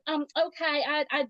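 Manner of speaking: high, soft, hesitant stammer; small, confused mimicked voice; bewildered phone rep. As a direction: imitate a baffled agent lost for words. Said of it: "This poor woman, she's like".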